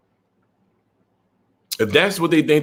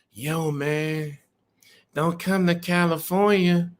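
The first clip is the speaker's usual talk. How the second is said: slow, lightly voiced mock-tough warning; imitating the kid; 5 dB quieter, slower than usual. Trucker mimics young driver warning him off California, slow mock-tough voice. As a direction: drag it out like a half-hearted street threat. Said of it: "he basically was saying like this".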